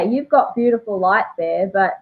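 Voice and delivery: ordinary talk, nothing marked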